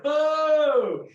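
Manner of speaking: sudden, high loud "oh!"; imitated yell. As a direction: belt out a startling cry in the night. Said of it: "and the yelling in the room".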